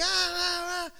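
high, held-back mock scream. Preacher imitating a couple fighting outside, mock screaming. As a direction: imitate a screaming couple without full volume. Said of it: "they're yelling and screaming".

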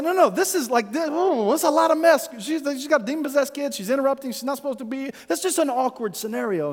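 high, flustered mock panic; real nervous. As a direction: act out nervous church folk objecting. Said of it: "Get real nervous".

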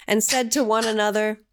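solemn scripture reading. Acted. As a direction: intone it like ancient scripture. Reads scripture aloud.

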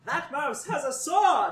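high alarmed laughing shout. Acted. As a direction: yell it in alarm. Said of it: "You hear someone shout".

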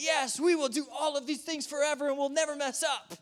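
high, bright over-eager promise; mock-earnest vow; eager naive crowd. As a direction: play the naive, gushing crowd. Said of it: "they're all like".

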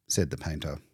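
usual speaking voice

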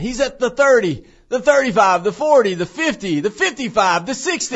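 high, loud, building football call; high school announcer calling a long run past the fifty; play-by-play call. Retold or imitated. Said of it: "I went".

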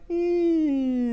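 fan hum. Retold or imitated. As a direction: imitate the hum of a stuffy elevator fan. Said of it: "the sound of the fan going".

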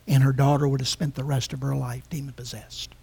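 fast unvoiced whisper; hushed grave. Real, not acted. Preacher telling the daughter's fate, in a fast whisper. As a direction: drop to a whisper for the tragedy.

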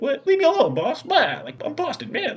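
high, loud mocking Boston bro voice; dumb Boston tough guy. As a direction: ham up a dopey Boston robber brushing off his boss. Retelling movie, Boston robber talks back.

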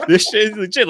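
giddy laughing echo of the quote; laughing repeat. Retold or imitated. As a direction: repeat it while cracking up.

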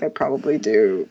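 sheepish drop to a low pitch; low, amused climb-down after pretend offence; mock admission. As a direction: concede it, pitched far down.

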